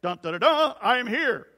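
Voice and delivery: loud hummed fanfare then a heroic line; a hammed-up, laughing superhero entrance. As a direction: hype yourself up like a cartoon hero. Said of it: "his best superhero stance".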